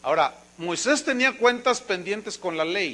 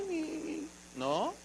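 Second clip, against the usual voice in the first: mock meek whimper; 8 semitones higher, 12 dB quieter than usual. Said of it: "mansedumbre no es así".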